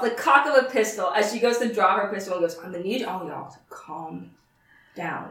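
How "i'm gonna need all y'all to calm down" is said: low, soft, tightly held-in warning; barely restrained. Acted. Sheriff drawing her pistol warns everyone, barely restrained. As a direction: keep it quiet, holding yourself back. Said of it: "she's barely restraining herself".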